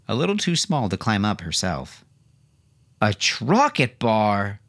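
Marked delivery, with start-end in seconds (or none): 3.0-4.6 slow, drawn-out A Trocket bar with Trocket stretched in fake shock; a dad playing shocked at his kids; mock surprise. A narrator's reading. Roland teasing his son, mock surprised. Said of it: "Roland exclaimed in mock surprise".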